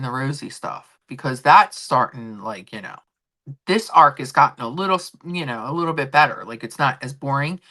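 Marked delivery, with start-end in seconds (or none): none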